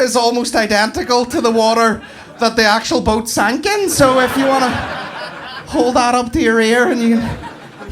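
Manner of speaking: high nervous tour-guide voice, slowing at first; flustered staffer bluffing through the pitch; nervous guide selling a fake exhibit to tourists; panicked museum guide. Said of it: "one panicked member of staff just kinda going".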